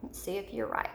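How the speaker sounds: ordinary delivery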